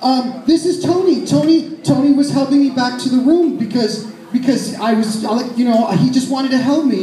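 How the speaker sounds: high, flustered stammer; panicked. Retold or imitated. Caught drunk woman explains the man to her friend, high and flustered. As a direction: babble excuses high and fast-thinking. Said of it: "her panicked look".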